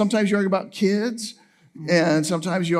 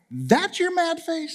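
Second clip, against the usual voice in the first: much higher, slow and drawn out in disbelief; incredulous question; 11 semitones higher, slower than usual. Retold or imitated. Husband teasing wife about her mad face, high and incredulous. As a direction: stretch it out, astonished she calls that angry. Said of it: "And I'm like".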